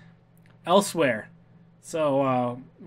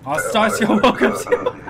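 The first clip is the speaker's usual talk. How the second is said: laughing welcome; 3 semitones higher, 4 dB louder than usual. Real, not acted. Mock official greeting while laughing.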